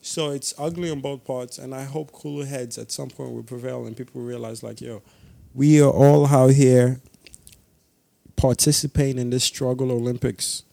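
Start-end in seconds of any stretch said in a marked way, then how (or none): none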